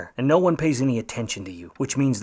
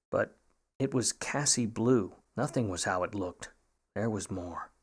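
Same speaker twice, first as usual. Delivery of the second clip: noir narration; 5 dB quieter than usual. A narrator's reading. Detective narrates the story.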